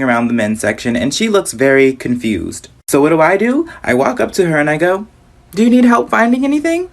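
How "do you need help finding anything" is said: high-pitched customer-service offer; acted polite offer of help, pitched up; helpful store worker.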